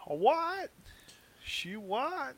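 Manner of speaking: high, puzzled what; mock confusion. Host pretending not to follow, high and puzzled. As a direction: act baffled, pitch up.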